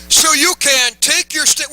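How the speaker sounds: a preacher calling the verse out with force; bold declaration. Shown as a demonstration. Preacher modelling the verse for the congregation, proclaimed boldly. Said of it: "Would you say it with some hair on it?".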